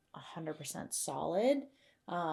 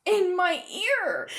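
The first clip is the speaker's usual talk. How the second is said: laughing; 11 semitones higher, 8 dB louder, slower than usual. Real, not acted. Laughing fit continued.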